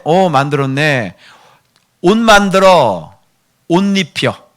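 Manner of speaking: high, loud, slow divine command; God's voice. Acted. God ordering the priest clothed, high loud command. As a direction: proclaim it as God giving orders.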